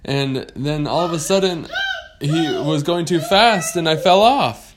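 high, loud, emphatic explaining; lost pirate girl. Acted. Pirate telling a shark her mishap, high animated voice.

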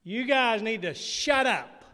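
hollered. Retold or imitated. Dad hollers at the squabbling families in the house, hollered. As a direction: holler it slowly, putting weight on the order. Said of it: "My dad hollered".

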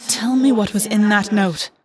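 slow, pressing hiss, understated; mild narrated hiss from August; hissed demand. A narrator's reading. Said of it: "August hissed".

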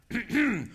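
showy throat-clear before performing; mock throat-clearing. Blind beggar readying his voice to shout, mock throat-clearing. Mimes warming up the voice.

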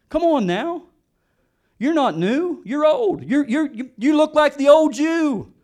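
loud, high taunting accusations, building; mounting accusation. Acted.

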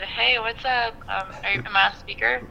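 ordinary delivery

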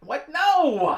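loud, high cry of protest; outraged shout. Acted. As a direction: shout it in disbelief. Outraged at the surrender idea.

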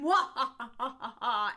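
slow, drawn-out evil laugh; mad scientist laugh. Retold or imitated. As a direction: cackle like a cartoon villain. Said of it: "he could have gone".

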